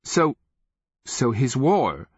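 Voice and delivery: put-on thrown, stammering young king; stammered. A narrator's reading. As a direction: stumble on the first word, then ask it. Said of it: "the Sakarpi king stammered".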